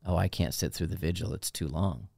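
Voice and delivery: low, quiet whiny voice; whiny complaint. Retold or imitated. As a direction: moan it lazily under breath. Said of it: "then be like".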